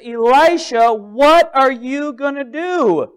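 loud, high, drawn-out appeal; desperate cry. Acted. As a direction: cry out for help, stretching the words. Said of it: "she's crying out in desperation".

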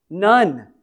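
ordinary talk

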